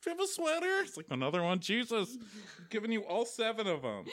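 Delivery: put-on high, put-upon whining boyfriend; mock a needy boyfriend begging for a sweater; whiny Travis. Travis whining about the cold.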